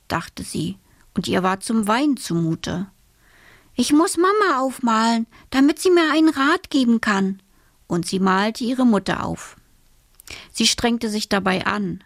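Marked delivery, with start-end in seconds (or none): 3.8-7.4 higher, lightly voiced girl; girl hitting on an idea; little girl character. A narrator's reading. Alice decides to paint Mama for advice, in a higher voice.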